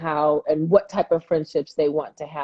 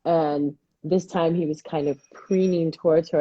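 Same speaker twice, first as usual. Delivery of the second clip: usual speaking voice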